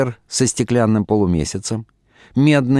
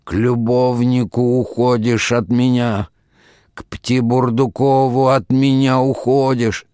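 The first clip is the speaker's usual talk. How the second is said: slow declamation rising higher as it builds; drawn-out whining verse; 4 semitones higher, 3 dB louder, slower than usual. A narrator's reading. Husband denounces wife, revelling in grief, declaiming slowly. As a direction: chant it in iambs, revelling in grief. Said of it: "продолжал Лоханкин в том же тягучем тоне".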